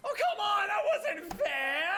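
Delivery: high, slow indignant complaint; sore loser whine. Acted. The beaten halfling protests loudly to Brick, high and whiny. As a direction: whine that it was unfair.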